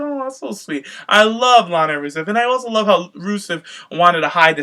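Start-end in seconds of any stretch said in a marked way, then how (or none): 0.0-0.9 gushing. Retold or imitated. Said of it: "I was like".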